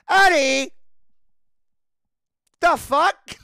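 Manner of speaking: high, loud, startled protest; loud, scandalized yelp; indignant shout. Retold or imitated. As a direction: shout back in mock outrage. Acts out startled neighbour's protest.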